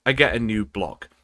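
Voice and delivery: ordinary talk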